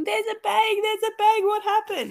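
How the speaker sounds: put-on high, shouting little kid; imitated screaming kid; child's excited yell. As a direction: call out like a startled child. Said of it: "comes running in screaming".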